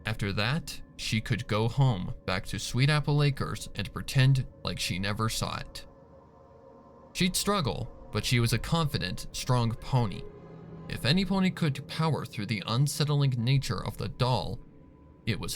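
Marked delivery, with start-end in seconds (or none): none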